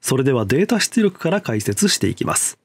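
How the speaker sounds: usual speaking voice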